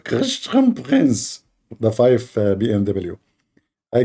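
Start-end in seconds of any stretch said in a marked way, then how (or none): 0.0-1.4 very high and slow; drawn-out squeaky name. Retold or imitated. Asking the audience to judge his squeaky imitation. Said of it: "which one is a nicer voice".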